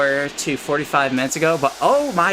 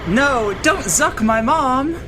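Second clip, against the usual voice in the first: silly plea; 7 semitones higher, slower than usual. Acted.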